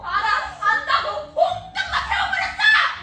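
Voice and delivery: very loud, high, drawn-out scream; screamed retort. Acted. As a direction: yell back at full force. Wife screams back in quarrel.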